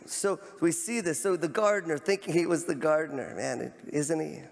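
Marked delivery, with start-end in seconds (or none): none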